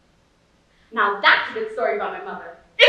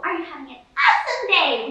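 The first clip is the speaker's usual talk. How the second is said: raised, punchy mock cheer; imitating an overeager cashier; chirpy counter girl; 5 semitones higher, 5 dB louder than usual. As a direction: push AWESOME loud and high. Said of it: "the girl behind the counter said".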